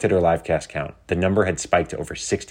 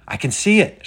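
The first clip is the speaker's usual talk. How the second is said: high, raised call; excited shout; 10 semitones higher, 6 dB louder than usual. A narrator's reading. Riven spots the city from the blimp, shouting. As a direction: call out a first sighting. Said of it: "shouted Riven".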